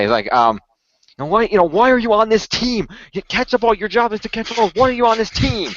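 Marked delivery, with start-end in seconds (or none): 1.2-5.7 higher, mock-exasperated scolding; exasperated rant. Teammate chewing out Kofi over dropped catches, hammed-up exasperation. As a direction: berate a useless receiver, pitched high.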